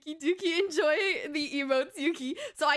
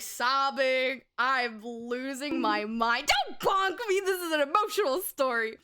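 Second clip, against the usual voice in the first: ordinary talk, nothing marked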